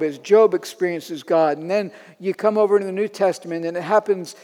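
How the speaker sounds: ordinary talk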